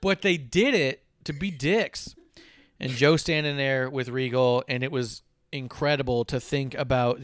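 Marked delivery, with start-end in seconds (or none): 0.0-2.1 chuckling through the words; laughing. Real, not acted. Host amused by the crowd's taunt, laughing. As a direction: laugh while you say it.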